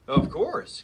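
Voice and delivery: loud, self-important mock growl. Wolf boasting to the giant, loud self-important mock growl. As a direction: puff up and growl it proudly. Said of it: "growled the wolf importantly".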